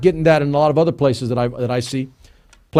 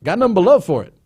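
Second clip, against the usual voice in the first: cheeseball; 6 semitones higher, faster than usual. Real, not acted. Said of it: "I'm gonna be a little cheeseball about this".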